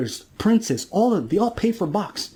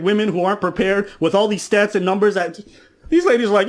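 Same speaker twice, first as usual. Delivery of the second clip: ordinary delivery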